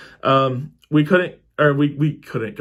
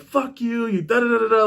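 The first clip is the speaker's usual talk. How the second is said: retold cussing out; 7 semitones higher than usual. Brother cussing out the passed-out storyteller, mock angry rant. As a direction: imitate a tirade trailing into nonsense syllables. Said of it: "while he's cussing me out".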